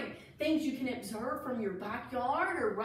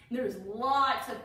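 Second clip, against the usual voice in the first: ordinary delivery, nothing marked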